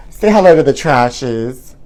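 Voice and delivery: ordinary talk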